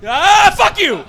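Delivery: high, strained scream held back in volume; mock frustrated scream. Struggling comic to Blake Griffin, mock frustrated scream. Jealous outburst at rival's easy success.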